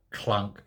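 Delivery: low, soft spoken clunk of a heavy door; understated imitated clunk; mock door clunk. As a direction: mouth the thud of a door shutting. Mock gas chamber door.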